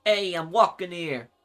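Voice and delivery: slow New York accent. Shown as a demonstration. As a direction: drag it out like a New York cabbie. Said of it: "the more deeper New York accent".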